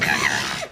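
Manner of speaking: unvoiced imitated bratty wail; imitation of a child's raspy whine; mock tantrum cry. Little girl refused cookies, mock tantrum cry. Girl's reaction to being refused.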